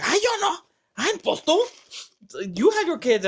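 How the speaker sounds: high-pitched mock protest; squeaky objection, putting on her voice; imitated protesting sister.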